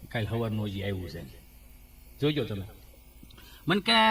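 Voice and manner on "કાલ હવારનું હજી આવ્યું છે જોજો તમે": put-on a doubting old father grumbling about his son; low soft grumbling father. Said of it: "હું કે".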